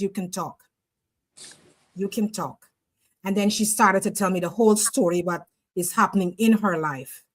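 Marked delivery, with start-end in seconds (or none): 0.0-2.7 soft and low, coaxing her to open up; gentle coaxing. Retold or imitated. Softly coaxing a crying client to talk. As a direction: hold her hands and softly invite her to speak.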